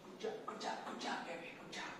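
soft praising. Real, not acted. Praising dog softly.

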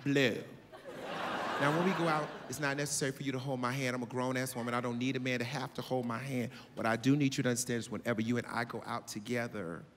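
calm and in total control; grown woman voice. Retold or imitated. Voicing the older woman.

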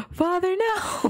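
high, drawn-out mock cry of dismay; hammed-up child pleading, slow and high; mock dramatic plea. Playing a child crying out to father, mock dramatic.

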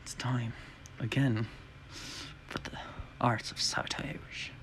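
hushed scheming whisper; villain whisper. Acted. Impostor plotting alone, whispering.

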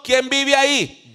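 loud, high call through a door. Acted. Caller at the believer's door, calling out loudly. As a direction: call out slowly to whoever is inside.

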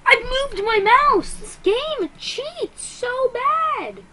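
high-pitched, loud, slow drawn-out protest; furious yell. Real, not acted. Gamer screaming at the game after an unfair death, furious. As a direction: scream it at the game in real outrage.

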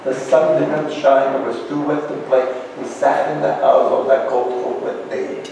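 put-on children's verse read to show rhythm; rhythmic rhyme. Shown as a demonstration. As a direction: read it with a bouncing beat. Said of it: "think of Doctor Seuss".

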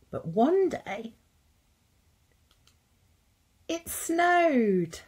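slow build, then a high, delighted "snowed"; storyteller's big moment, rising to a high excited finish; dramatic reveal. A narrator's reading. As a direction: hold the pause, then reveal it with wonder.

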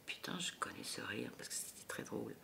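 hushed unvoiced inner thought; inner whisper. Onstage, recognising a laugh in the audience, whispered to herself. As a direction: whisper it to yourself in surprise. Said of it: "Je me dis".

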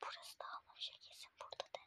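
barely audible whisper; secretive whisper. Real, not acted. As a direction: whisper from your hiding place. Prankster hiding, secret aside. (gizlice fısıldayarak)

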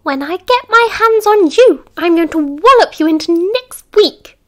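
high, loud menacing screech; threatening matron. A narrator's reading. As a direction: threaten the hidden boy, screeching. Matron hunting the boy.

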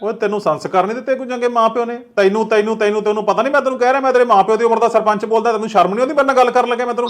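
furious shout. Acted. Sarpanch yells at the disrespectful boy on the phone, furious and fast. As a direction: blow up at him, rattling it off. Sarpanch explodes at rude caller.

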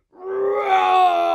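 huge, loud, high lion roar; full-force playful lion roar; big roar. Shown as a demonstration. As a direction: let out a long shouted roar. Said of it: "Big roar at the end".

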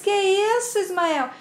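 high, lightly acted protest; offended surprise. Regina protests at Ismael's denial, offended surprise. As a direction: object as if taken aback. Regina reacts to being contradicted.

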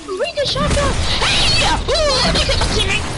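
high, loud hyped yelling; excited shouting. Real, not acted. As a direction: hype up the kill.

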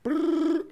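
high buzzy ring; phone ring. Retold or imitated. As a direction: imitate a phone ringing. Imitating phone ringing.

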